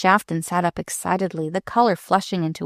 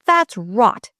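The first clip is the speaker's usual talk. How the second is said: loud, higher, rude dismissal; angry, contemptuous rebuff; 4 semitones higher, 6 dB louder than usual. A narrator's reading. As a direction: dismiss it rudely and forcefully. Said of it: "he said rudely".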